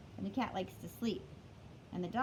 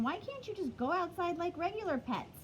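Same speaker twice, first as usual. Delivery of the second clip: lightly voiced dog, higher; 7 semitones higher than usual. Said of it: "the doggy's saying".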